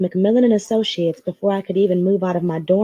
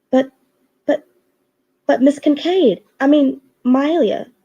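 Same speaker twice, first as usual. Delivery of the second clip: stuttering; 6 semitones higher than usual. Acted. Secretary stammering at her boss, flustered. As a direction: trip over the words in a panic. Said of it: "she stuttered".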